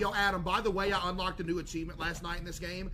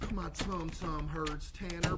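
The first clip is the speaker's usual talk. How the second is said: ordinary delivery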